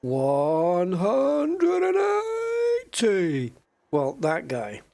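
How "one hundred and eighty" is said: very slow, drawn-out, rising darts announcer call; darts scorer call. Retold or imitated. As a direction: stretch it out like the darts caller at the oche. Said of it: "the scorer... when he'll go".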